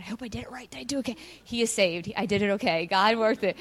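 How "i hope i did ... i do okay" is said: much softer, worried self-questioning; anxious murmur. Retold or imitated. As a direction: mutter your doubts under your breath. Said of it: "I kept going".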